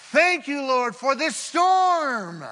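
high and loud, slow and passionate; loud declaration. Shown as a demonstration. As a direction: proclaim it with full conviction. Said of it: "Can you say this morning".

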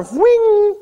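a sharp, high whing like metal flying off; high whing sound effect. Retold or imitated. Preacher mimicking the axe head flying off with a high whing.

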